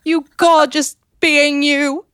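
high, slow swooning gush; swooning lady. Acted. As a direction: sigh out his name adoringly. Mock swooning reply.

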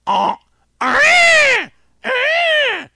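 piercing high shrieking bird call, far above the voice; loud imitated peacock cry; peacock honk scream. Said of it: "that sounds exactly like this".

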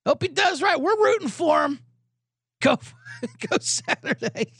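fake-polite cheering for the new coach; mock cheery pundits. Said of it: "they're all like".

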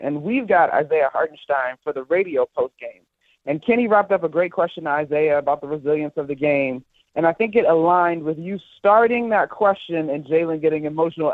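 normal talking voice

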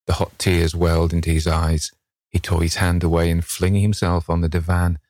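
usual speaking voice

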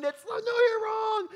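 high, slow, loud shout; mock scream. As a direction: scream it at the contestant. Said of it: "audiences screaming".

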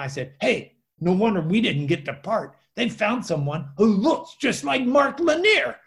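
loud, excited imitation of a Spanish accent; thick Spanish accent. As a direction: build to the punchline in a put-on Spanish accent. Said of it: "with a really thick, uh, Spanish accent".

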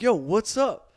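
loud, high, swaggering greeting; mock-cocky street hello; cocky attitude. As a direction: strut it out loud, full of yourself. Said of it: "I got an attitude".

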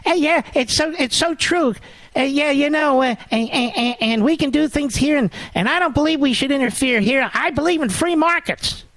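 high, halting mock voice; dopey mock politician. Host mocking a governor who sounds dazed, high voice. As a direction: play him dazed and stammering. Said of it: "Guy sounds like he's had one too many".

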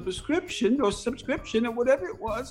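put-on frail patient. Caller fakes a dazed patient voice to the agent. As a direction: play a confused patient just out of surgery. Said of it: "I got into my, my Actors Guild".

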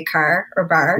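"car" and "bar" in a Newfoundland accent. Shown as a demonstration. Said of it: "like the way I say".

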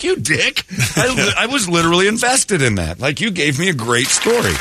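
high-pitched comic indignation; mock outrage. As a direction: protest as if cheated out of a story. Acted complaint at prankster.

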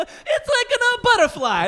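very high, loud, drawn-out mock-Italian cry; hammed-up Italian outburst, slow and wailing; emotive Italian accent. Chef guessing Rorschach images, wildly emoting in mock Italian. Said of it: "he just emotes in Italian".

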